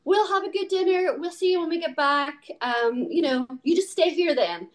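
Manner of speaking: high, breezy fake-serious tease; sing-song teasing mom; mock casual teasing. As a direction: pretend to leave him behind, lightly. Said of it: "And I'm like".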